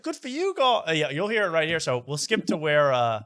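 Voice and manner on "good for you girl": high mock British woman; hammed-up British impression, pitched way up; fake bad British accent. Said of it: "she's, like".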